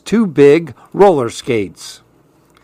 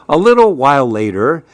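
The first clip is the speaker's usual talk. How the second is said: ordinary delivery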